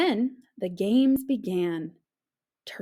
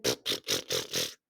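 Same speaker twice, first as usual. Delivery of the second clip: snore puffs; 5 dB quieter than usual. Shown as a demonstration. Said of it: "sounds like".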